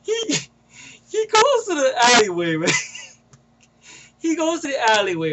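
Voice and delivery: high, squeezed voice breaking into laughter; cracking up. Real, not acted. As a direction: struggle to get the words out through laughter. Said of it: "This part always makes me crack up".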